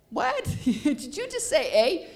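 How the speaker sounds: high-pitched, hammed-up astonishment; mock surprise. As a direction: squawk it in comic disbelief, half laughing. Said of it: "if you wanna really, uh, surprise a Canadian".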